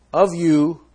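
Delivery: ordinary talk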